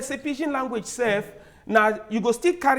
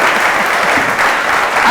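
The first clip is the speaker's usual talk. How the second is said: loud laugh; 8 dB louder than usual. Real, not acted. Laughs after market mama quote.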